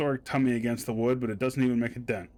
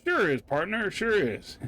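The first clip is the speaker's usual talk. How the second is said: cowboy voice; 7 semitones higher, slower than usual. Acted. Cowboy character voice.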